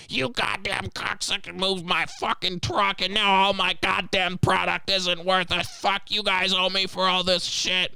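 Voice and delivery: high, slow mocking trucker rant; mock angry trucker. Worker mimicking the trucker demanding payment, high and mocking. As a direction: whine it out like an outraged trucker, pitched high.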